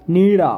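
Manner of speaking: नीड़ा, loud, ड़ flapped; demo pronunciation. Shown as a demonstration. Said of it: "काड़ा".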